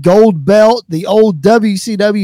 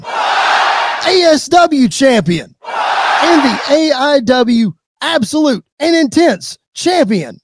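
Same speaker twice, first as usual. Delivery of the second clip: mock shout; 6 semitones higher, slower than usual. [name] listing belts, shouting.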